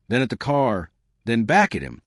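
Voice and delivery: ordinary delivery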